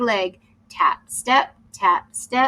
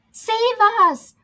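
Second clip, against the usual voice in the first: high pleading call; crying out; 12 semitones higher, 4 dB louder than usual. Acted. Trapped pirates calling for rescue, high plea. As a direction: cry for help from the jar. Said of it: "cried the pirates".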